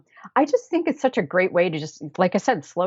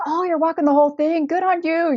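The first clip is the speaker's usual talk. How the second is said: high, bright praise; cheery Scots hikers; 12 semitones higher, 3 dB louder than usual. Retold or imitated. Scottish day hikers praising the walker, high and cheery. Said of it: "they'd be like".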